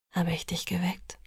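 soft unvoiced whisper in the dark. A narrator's reading. Edward whispering to sleepy Bella at night, hushed. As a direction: breathe it so she barely wakes. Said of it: "flüsterte er".

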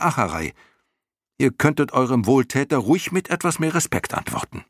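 indignant high-pitched scolding; fairly angry. A narrator's reading. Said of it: "schrie Bastian nun doch ziemlich wütend". (wütend und empört schimpfend)

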